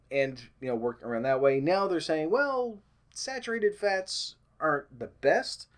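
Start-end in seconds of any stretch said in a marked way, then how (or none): 2.3-5.7 high, slow and drawn-out mocking voice; mock expert. Vlogger mocking the new expert advice in a high, slow voice. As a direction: parody a hedging expert, slowly and high.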